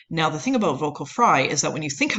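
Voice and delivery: ordinary talk, nothing marked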